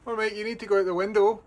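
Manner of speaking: high, fast urging; coaxing a wild bird. Real, not acted. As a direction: hurry the bird along.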